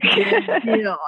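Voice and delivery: chuckling as you say it; laughing. Real, not acted. Host laughs at guest's reply.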